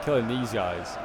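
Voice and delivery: ordinary talk, nothing marked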